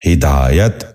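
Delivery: ہدایت said as "hi-daa-yat", short i after h; correct pronunciation. Shown as a demonstration. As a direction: open ہدایت with "hi". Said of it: "درست تلفظ ہے".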